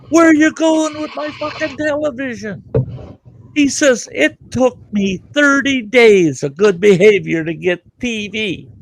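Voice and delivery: high, furious yell of outrage; going ballistic. Retold or imitated. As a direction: scream it like a man who just lost his TV. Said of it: "That guy went ballistic".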